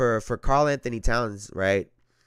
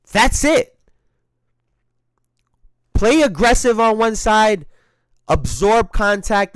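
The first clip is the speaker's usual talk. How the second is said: high, loud insistent instruction; raised voice, telling him plainly; emphatic coaching; 8 semitones higher, 8 dB louder than usual. Real, not acted. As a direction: drive the point home. Coaching the player.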